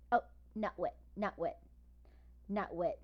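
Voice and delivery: usual speaking voice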